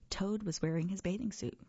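ordinary delivery, nothing marked

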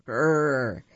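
rolling growl like a tiger. Acted.

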